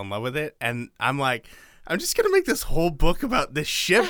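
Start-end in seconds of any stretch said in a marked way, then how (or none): 1.9-4.0 very high, giddy enthusiasm; gushing excitement. Retold or imitated. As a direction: gush about it like a besotted fan. Acting out infatuation with a ship.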